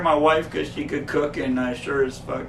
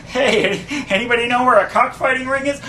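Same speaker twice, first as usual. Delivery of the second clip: higher, louder, fast and laughing; mock Kramer asking around; 9 semitones higher, 7 dB louder than usual. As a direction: blurt it out half laughing. Acted street question, laughing.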